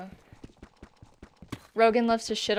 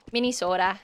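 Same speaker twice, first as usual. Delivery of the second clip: Minnesota accent; faster than usual. Shown as a demonstration. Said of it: "I love saying it like that too".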